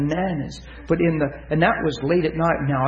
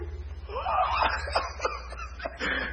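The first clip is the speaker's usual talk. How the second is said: high, breaking mock sobs; imitated bawling; 10 dB quieter than usual. Woman bawling after her prayer, imitated high sobs. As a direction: sob wordlessly in a high pitch. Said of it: "she's just bawling and bawling".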